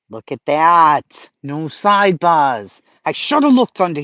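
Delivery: loud, slow, savoring delight. Real, not acted. Streamer showing chat the playlist, loud slow delight.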